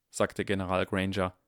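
plain reading voice

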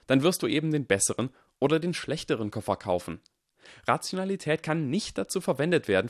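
put-on earnest young lecturer; Harry lecturing. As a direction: lay out the argument with youthful conviction. Harry lectures Draco on science.

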